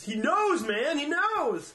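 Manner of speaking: high, slow, laughing tease; teasing stoner. Retold or imitated. Friend teasing the paranoid narrator, high slow laughing. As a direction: drag it out to spook him.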